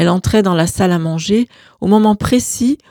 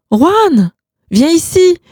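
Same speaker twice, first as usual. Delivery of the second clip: high, loud desperate call; screamed; 10 semitones higher, 5 dB louder, slower than usual. Acted. Gloria screaming at her husband to come, high and loud. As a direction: scream his name across the room. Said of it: "hurla Gloria".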